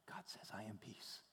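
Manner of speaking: breathy whisper of God's answer; hushed whisper. Acted. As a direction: whisper it like a secret promise.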